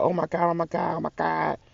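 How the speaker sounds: imitating a frantic mom; panicked mother. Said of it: "My woman started freaking the fuck out".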